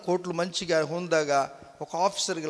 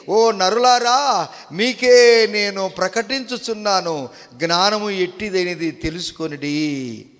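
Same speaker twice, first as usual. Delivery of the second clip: slow, loud, raised proclamation; loud crying out; 3 semitones higher, 8 dB louder, slower than usual. Acted. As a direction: call out to all mankind, easing at the end. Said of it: "గట్టిగా కేకలేసి". (గట్టిగా కేకవేసి ప్రకటిస్తూ)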